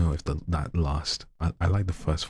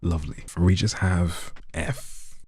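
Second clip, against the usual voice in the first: ordinary talk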